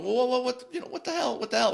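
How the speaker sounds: high startled cries of trapped people; alarmed yelling. Retold or imitated. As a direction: mimic panicked voices from the elevator.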